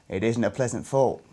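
usual speaking voice

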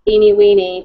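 ordinary talk

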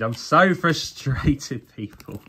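slow, drawn out, laughing through it; laughing frustration. Real, not acted. To his viewers, he laughs slowly at his own frustration. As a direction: stretch it out with a rueful laugh.

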